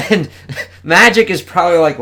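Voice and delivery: usual speaking voice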